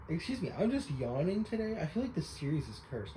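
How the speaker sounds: ordinary delivery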